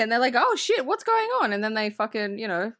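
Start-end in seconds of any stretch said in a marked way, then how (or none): 0.4-1.5 high-pitched mock alarm; much higher, hammed-up surprise; acted startled waking. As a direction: wake up in a comic panic. Acts out characters waking up startled.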